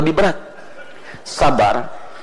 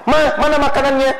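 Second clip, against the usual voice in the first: mock husband; 10 semitones higher than usual. Husband asking wife for food.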